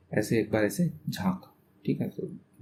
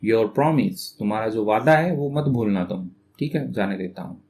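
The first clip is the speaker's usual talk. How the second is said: usual speaking voice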